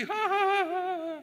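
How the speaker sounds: high mock cackle of the enemy; mock laugh. Enemy laughing at cards in the coffee stall, high mock laugh. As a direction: ham up his carefree laugh. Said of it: "Ketawa ke T V".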